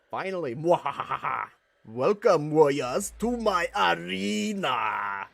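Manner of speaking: loud cackling villain, slow booming end; mad scientist. Acted. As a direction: cackle then bellow the welcome. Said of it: "Oh, that's the voice for that".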